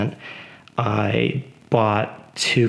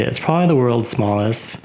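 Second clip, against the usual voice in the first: ordinary talk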